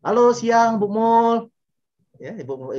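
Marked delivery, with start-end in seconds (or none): 0.0-1.5 high, loud, sing-out hello; cheery phone greeting. Acted. He rings a friend to say good afternoon, cheery phone greeting. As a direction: call out a bright telephone hello, pitched well up. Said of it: "saya telepon misalkan ke Bu Mul".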